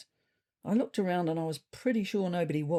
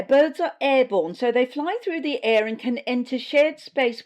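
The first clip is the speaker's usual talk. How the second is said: higher and louder, preachy bureaucrat voice; pompous official briefing; 8 semitones higher, 5 dB louder than usual. Acted. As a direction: lecture the cats like a self-important health official. Susie lectures at the podium.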